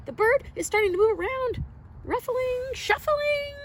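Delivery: eager high storytime excitement; excited. Acted. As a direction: rush it out in excitement. Egg hatching excitement.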